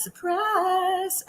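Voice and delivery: high, drawn-out sing-song reveal; sarcastic ta-da lilt; mock surprise. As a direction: sing it out teasingly, held high. Mock announcement of the secret marriage.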